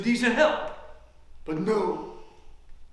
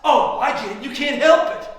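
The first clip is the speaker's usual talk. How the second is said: loud, mocking throw-back of the protest; sarcastic echo; 5 semitones higher, 10 dB louder than usual. Loser throws winner's excuse back, angry sarcasm. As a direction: fling the excuse back, lifting "help".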